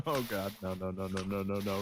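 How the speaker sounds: breathless panicked muttering; desperate pleading under breath; terrified horror victim. Real, not acted. Fleeing the bear.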